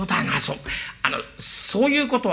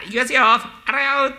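rickshaw puller's cry; 8 semitones higher than usual. Acted. Rickshaw man calls out running.

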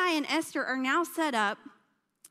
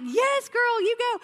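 loud, high hype cheer; cheering her on; 11 semitones higher, 7 dB louder than usual. Retold or imitated. Youth leader cheering the queen's refusal, loud high hype. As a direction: hype up the queen like a best friend.

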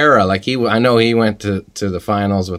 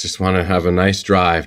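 normal talking voice

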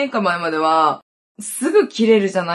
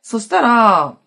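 ordinary talk

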